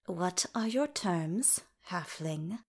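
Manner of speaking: slow, quiet, grudging demand; through gritted teeth. A narrator's reading. Elven King asks the halfling for terms, through gritted teeth. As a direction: ask softly and slowly through gritted teeth. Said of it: "spoke through gritted teeth".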